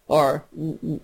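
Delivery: ordinary talk, nothing marked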